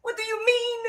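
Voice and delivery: very high, incredulous exasperation; outraged disbelief. Real, not acted. Outraged at praise of those countries.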